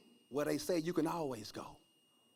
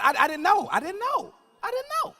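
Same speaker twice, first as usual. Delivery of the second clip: climbing higher each time; rising disbelief; 9 dB louder than usual. Real, not acted. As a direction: build up in disbelief.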